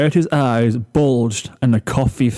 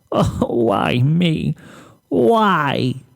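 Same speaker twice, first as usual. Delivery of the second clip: sobbing lament; 4 semitones higher, much slower than usual. Acted. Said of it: "choking on ugly, dry sobs".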